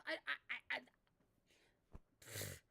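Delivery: ordinary delivery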